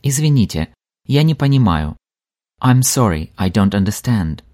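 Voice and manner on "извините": ordinary talk, nothing marked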